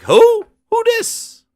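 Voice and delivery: very high mock squeal; baffled outcry. Viewer as Rita turns around, baffled squeal. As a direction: squeal it as if thrown. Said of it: "you're like".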